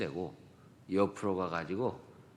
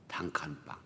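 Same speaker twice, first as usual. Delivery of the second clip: whispered aside; 4 semitones lower, 6 dB quieter than usual. Real, not acted. Describing the tiny one-room parsonage.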